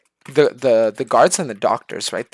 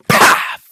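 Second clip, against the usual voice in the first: loud, breathy smack noise; imitated punch sound; 8 dB louder than usual. Acting out being beaten awake, imitated punch sound. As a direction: voice the blow as an explosive puff.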